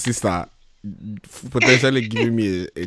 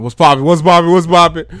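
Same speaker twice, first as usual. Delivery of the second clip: hyped greeting; 7 semitones higher, 9 dB louder than usual. Acted. Hyped repeated show greeting.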